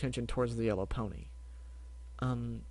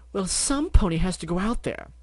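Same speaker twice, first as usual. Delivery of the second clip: louder, urgent; exclaiming; 7 semitones higher, 6 dB louder than usual. Acted. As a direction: press the point eagerly. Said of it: "Rarity exclaimed".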